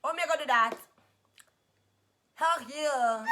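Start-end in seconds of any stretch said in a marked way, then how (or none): 2.3-3.2 tongue out, slurred and laughing; tongue sticking out. Real, not acted. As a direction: poke tongue out and say it. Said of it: "stick your tongue out and say".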